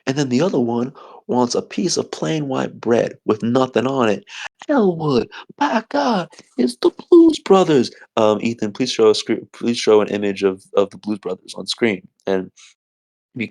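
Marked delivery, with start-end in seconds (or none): 4.7-7.9 high, loud amazed cry of recognition; excited exclamation. Acted. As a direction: shout it like you can't believe who walked in. Character spots the Blues Brothers.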